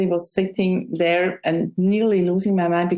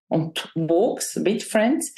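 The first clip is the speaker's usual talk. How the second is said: ordinary talk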